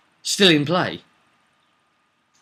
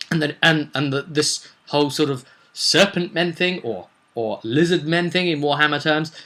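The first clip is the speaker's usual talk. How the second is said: usual speaking voice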